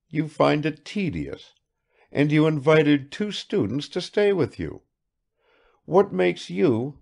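a hint of a probing guest; lightly voiced Bazarov, probing. A narrator's reading. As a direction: lightly play a man puzzling someone out.

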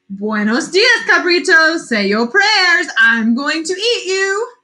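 menacing monster. A narrator's reading. Chupacabra threatening the middle goat, menacing. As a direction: threaten with relish.

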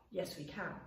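soft and hushed; quiet. Shown as a demonstration. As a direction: say it softly, almost whispering. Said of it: "Can we be quiet with our feet?".

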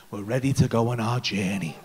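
deep, solemn guru voice; breathwork guide. Retold or imitated. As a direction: drop low to announce the journey. Guide announces the journey.

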